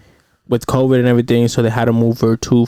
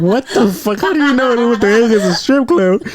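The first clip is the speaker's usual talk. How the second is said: 1 shocked; 10 semitones higher than usual. Retold or imitated. Wife shocked at child's words.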